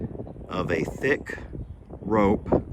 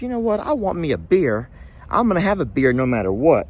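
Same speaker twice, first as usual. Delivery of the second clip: determined string; 6 semitones higher, faster than usual. Acted. String resolves to get beer.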